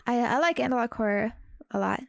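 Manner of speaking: normal talking voice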